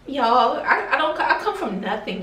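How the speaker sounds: half laughing through real tears, voice breaking high; overwhelmed, laughing and weeping at once; crying and laughing. Real, not acted. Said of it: "I don't like crying on camera".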